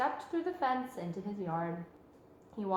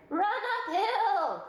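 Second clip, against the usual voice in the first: high, slow sheep voice; crying sheep; 11 semitones higher, 4 dB louder, slower than usual. A narrator's reading. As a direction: bleat it out slowly. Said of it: "cried the sheep".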